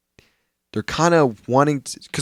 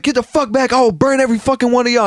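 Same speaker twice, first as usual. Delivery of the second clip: high-pitched mock angry threat; heated but not full-out yell; retold yelling; 10 semitones higher, 4 dB louder than usual. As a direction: imitate him yelling at passengers. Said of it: "like, yelling at all the passengers".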